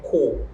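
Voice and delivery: cor with the final R dropped; non-rhotic cor, ending on the vowel; silent R. Shown as a demonstration. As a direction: leave the R out of cor. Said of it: "I will not pronounce that".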